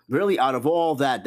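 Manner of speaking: ordinary talk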